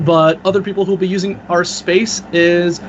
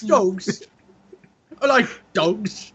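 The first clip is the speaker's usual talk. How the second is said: talking out of the side of the mouth; lopsided mouth, worst accent ever; out of mouth corner; 5 semitones higher, 5 dB quieter, much slower than usual. Pretend. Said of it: "I'm speaking out of the side of my mouth".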